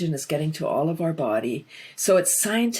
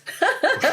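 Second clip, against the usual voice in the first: bright high laugh; 13 semitones higher than usual. Real, not acted. After naming the love drugs, a high laugh. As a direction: laugh at your own punchline.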